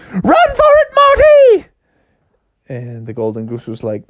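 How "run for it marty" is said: very high, loud shriek; panicked yell. Acted. Froggy warning the goose of the giant, panicked. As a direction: scream the warning in falsetto.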